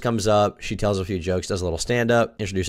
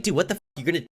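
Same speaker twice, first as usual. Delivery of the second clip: imitating angry comic; 8 semitones higher than usual. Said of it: "very genuinely angry at me".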